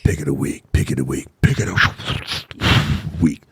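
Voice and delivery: low echoing announcer jingle with mouth-made whoosh; mouth-made radio sting; homemade jingle effect. Pretend. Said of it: "make the music with my mouth".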